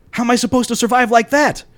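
high-pitched, distressed whine; whining protest. A narrator's reading. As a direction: wail it in mock despair. Paul whines his plea to Matt.

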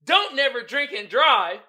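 loud, slowed mock warning; misread sign. Shown as a demonstration. Host shows how most people read the sign, loud deliberate misreading. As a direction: belt out the misread warning, stretching each half. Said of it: "this how most people read it".

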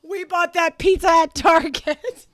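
hammed-up grief over the pizza; mock lament. Real, not acted. Mourning the pizza.

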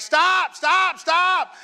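high, loud cry of stop; shouting to the men who dropped him; pained shout. Retold or imitated. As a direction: yell it out after being dropped twice. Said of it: "you just be like".

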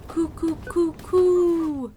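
loud, high alarmed coo coo coo; yelled coo. Acted. Rodney warning Quincy of intruders, loud alarmed coo. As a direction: yell a bird alarm. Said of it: "Rodney yelled".

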